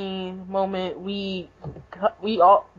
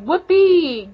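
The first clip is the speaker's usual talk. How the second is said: higher, louder imitated "Whoopee!"; mock cheer; 8 semitones higher, 4 dB louder than usual. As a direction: squeal "Whoopee!" like a happy kid. Kagura cheering in retold scene.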